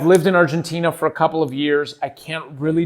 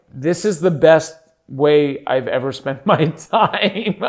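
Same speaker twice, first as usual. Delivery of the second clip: slow mock rave breaking into laughter; laughing imagined praise; slower than usual. Voicing the listeners' reaction, breaking up laughing.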